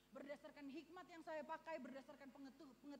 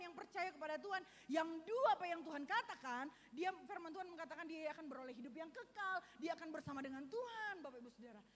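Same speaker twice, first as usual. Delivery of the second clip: louder, driving sermon build; preaching at full force; 3 semitones higher, 7 dB louder than usual. Acted. Pastor urges the congregation back to God's word, preaching hard. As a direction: press the point home to the church.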